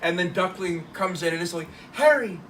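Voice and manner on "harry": loud, high call of a name; mock exclamation, raised and loud; acted calling out. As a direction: call out sharply to someone, in play. Character calls out to Harry.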